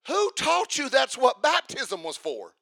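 high, loud, incredulous preaching; preaching at full force. Acted. Preacher challenging the crowd, loud and incredulous. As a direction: hammer the point home to the congregation. Preacher rebukes a baptism motive.

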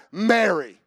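tender name. Acted. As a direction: say her name gently. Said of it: "Jesus saith unto her".

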